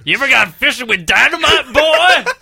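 high, loud character holler; hammed-up shout. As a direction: holler it like an over-the-top character. Acted holler in character, comic climax.

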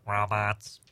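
low pinched-nose monotone; monotone robot. Shown as a demonstration. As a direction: drone it like a robot. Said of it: "speak in a monotone like this".

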